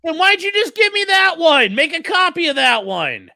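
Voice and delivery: high, loud indignant question; exasperated demand. Real, not acted. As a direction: shout back at the screen. Shouts back at the court video.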